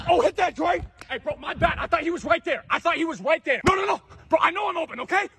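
high-pitched, agitated protest; on-court frustration. Acted. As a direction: plead your case to a teammate mid-game. Argues over a missed pass.

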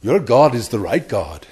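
light Pakistani accent, imitated; a hint of a Pakistani accent. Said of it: "in his kind of Pakistani accent voice".

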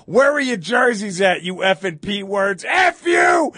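high, loud taunting yell ending in a shout; imitated heckling. Fans taunting Cowboys fans without jerseys, loud mocking yell. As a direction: heckle them hard. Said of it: "They go".

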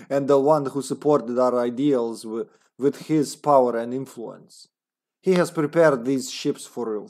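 put-on comrade Russian accent. Agent's journal about a final plan, Russian accent. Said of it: "a comrade accent".

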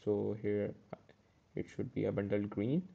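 ordinary talk

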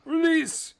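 loud echoed cheer; excited shout. Pretend. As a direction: throw back a happy yell. Echoing release shout.

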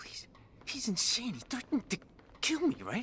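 high, loud, panicky disbelief; shrill panic. Real, not acted. Gamer realising the villain threatened to kill him, high panic. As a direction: voice jumps up in alarm.

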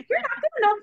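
very high-pitched cartoon mouse; Mickey Mouse. Shown as a demonstration. Inner villain repeating its taunt, squeaky cartoon mouse. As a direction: squeak it like a tiny cartoon critter. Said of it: "like, the Mickey Mouse voice".

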